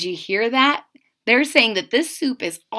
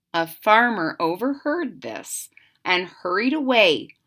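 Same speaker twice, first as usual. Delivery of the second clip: plain reading voice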